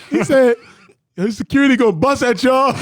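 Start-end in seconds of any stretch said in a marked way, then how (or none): 1.2-2.8 high, loud comic warning; retold warning. Player warning fans about security, loud mock alarm. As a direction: call it out high, half laughing.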